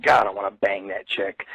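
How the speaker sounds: low, quiet aside to himself; muttered inner thought. Retold or imitated. Telling the audience what he thought of a girl, in a quiet aside. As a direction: voice the thought under your breath.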